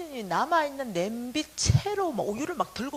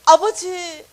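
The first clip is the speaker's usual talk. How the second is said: loud, higher cry to God; calling out to Father; 7 semitones higher, 15 dB louder, much slower than usual. Acted. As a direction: call out "Father" with sudden force. Cries out to God in story.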